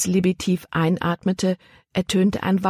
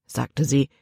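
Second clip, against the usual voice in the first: ordinary delivery, nothing marked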